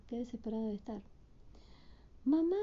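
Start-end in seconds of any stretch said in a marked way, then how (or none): 2.2-2.6 high, loud call. Acted. Hilda calling for her mother in the crowd. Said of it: "grita Hilda".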